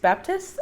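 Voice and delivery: "Baptist?" pitched very high with a questioning rise; unsure pronunciation. Real, not acted. Vlogger unsure how Baptist is pronounced, questioning. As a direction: test out "Baptist?" as if asking. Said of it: "that I pronounced it wrong".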